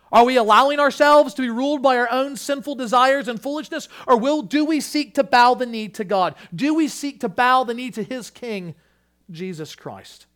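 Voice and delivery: high, loud, urgent questions; challenging full-force preaching. Real, not acted. Preacher asking church whom they bow to, full-force preaching. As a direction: press the congregation hard, loud and high.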